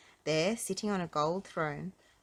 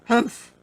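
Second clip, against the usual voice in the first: loud, higher-pitched snooty humph; rude humph; 6 semitones higher, 14 dB louder than usual. A narrator's reading. Mister Uppity rebuffing the goblin, rude sniffing humph. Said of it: "sniffed Mister Uppity rudely".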